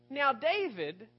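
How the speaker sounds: normal talking voice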